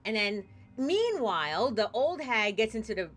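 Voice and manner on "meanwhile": normal talking voice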